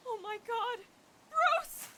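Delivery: very high cry of alarm; horrified. Acted. Woman realizes Bruce fell in the hole, horrified. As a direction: cry out high, rising to a shout. Horror at Bruce having fallen.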